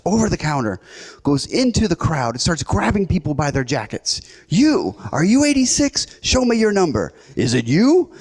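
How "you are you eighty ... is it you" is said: high, pushy rapid-fire interrogation; acted-out frantic clerk confronting customers; mock angry demanding. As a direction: accost strangers one by one, voice pitched up. Clerk grabbing customers, interrogating them.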